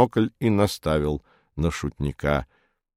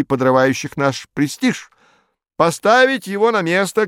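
higher-pitched, insistent committee man; fussy objecting official; 6 semitones higher, 5 dB louder than usual. A narrator's reading. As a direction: argue the point insistently in a raised pitch. Narrator voices objecting official Farfurkis. (настырный чиновник, высоким голосом)

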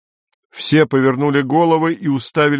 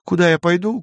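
mock desperate cry; 9 semitones higher, faster than usual. Denny retells the thrown-out censor's protest, crying out mildly. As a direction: imitate a protest with rising question. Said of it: "кричал он".

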